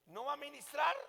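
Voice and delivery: high-pitched disbelieving query; mock astonishment on a question; retold surprised question. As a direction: imitate a puzzled pastor, voice jumping up. Acts out pastor's surprised question.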